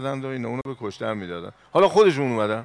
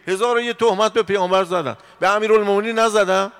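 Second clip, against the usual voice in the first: higher and louder rhetorical challenge; rising indignation; 8 semitones higher, 7 dB louder than usual. Acted. Preacher protesting slanders against the Prophet, loud. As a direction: build the outrage into the question.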